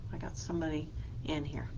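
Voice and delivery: quick real whisper; hushed aside about someone in the room. Real, not acted. Teacher whispers about visitor.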